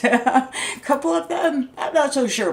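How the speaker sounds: high, laughing aside; real chuckle, voice lifted high; amused doubt. Real, not acted. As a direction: laugh through your doubts, pitch jumping up. Laughing at doubtful good wishes.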